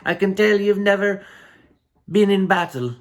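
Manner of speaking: hammed-up Afghan accent, second go. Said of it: "I can't do that kind of accent".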